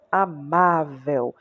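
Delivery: Amável said slowly, with a long "má"; stress on accented syllable. Shown as a demonstration. Teacher showing the class the tonic syllable, stress on "má" in Amável. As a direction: draw out the "má" in Amável. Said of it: "o acento agudo já diz qual é a sílaba tônica".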